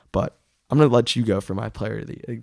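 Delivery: usual speaking voice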